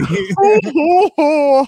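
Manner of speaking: high, loud creepy cackle; devilish giggle. Pretend. Scaring friends on a call, high devilish giggle. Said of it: "That's the devil".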